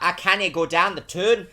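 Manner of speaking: mocking Middlesbrough impression; Andy's northern English accent. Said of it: "take the piss out of, like, Andy".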